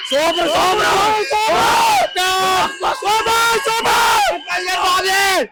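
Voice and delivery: drawn-out high excited cries of super; long yelled super super; cheering shout. Real, not acted. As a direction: cheer it like a fan after the show. Fan cheering outside theatre.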